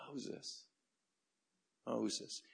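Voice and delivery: hushed, gentle name-calling; near-whisper first, then softly repeated; tender. Shown as a demonstration. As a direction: call the name with quiet kindness. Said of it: "He says it so tenderly".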